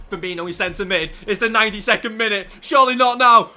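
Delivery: loud, rising TV commentator calling the final minutes; live match commentary. Pretend. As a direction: call it like a cup final on telly. Mock football commentary, big match.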